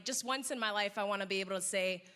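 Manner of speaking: ordinary delivery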